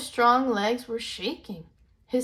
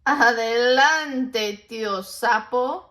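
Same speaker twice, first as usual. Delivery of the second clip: slow, louder call; calling back; 3 semitones higher, 7 dB louder, much slower than usual. Acted. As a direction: tease him again. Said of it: "called back again".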